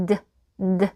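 "드" with vocal cords vibrating; voiced D sound. Shown as a demonstration. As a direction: hum into a short, isolated "드". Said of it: "드 사운드는 성대를 울린다".

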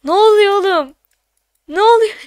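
high-pitched, bewildered complaint; dismayed disbelief. Real, not acted. Streamer baffled by the falling count, dismayed. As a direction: demand to know what's happening, dismayed.

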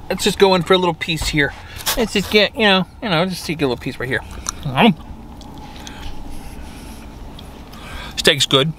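muffled words between chews; talking while chewing. Real, not acted. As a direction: mouth partly full of food. Seen 0: Bite taken just before; closed-mouth chewing, food visible at 3.4s.

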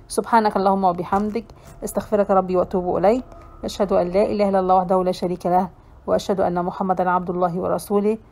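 very fast, low, soft rote recitation; closing prayer. Real, not acted. Teacher closes the lesson with prayer, rapid hushed recital.

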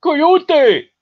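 Coyote as a high, loud ko-YO-tay; mocking California pronunciation. Trapper mimicking California douchebags, hammed-up mockery. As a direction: exaggerate Coyote with a big final tay. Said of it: "how the fucking California douchebags say it".

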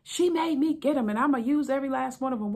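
high, loud and fast defiant rant; husband yelling, blaming the wife. Retold or imitated. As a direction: blame the wife at the top of your voice.

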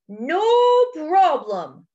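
mildly booming storybook elephant. Acted. Elephant offers to free Hippo, mildly booming. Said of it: "boomed Elephant".

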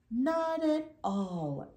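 slow, stony, unhurried; slow pebble voice. A narrator's reading. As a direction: answer like calm smooth stones. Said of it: "answered the pebbles".

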